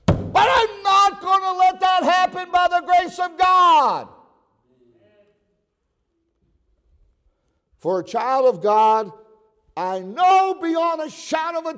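high, loud pulpit shout; impassioned shouted sermon with drawn-out key words; full-force preaching. Real, not acted. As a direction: thunder it out to the whole church.

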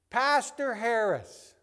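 high, drawn-out call; imitated long-distance hail; retold calling out. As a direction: call the name slowly across a room. Don spots him across the gym.

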